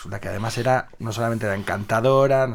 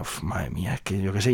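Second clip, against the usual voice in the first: softer, lower fretting; worried mutter; 5 semitones lower, 7 dB quieter than usual. Acted. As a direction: mumble it to yourself, worried.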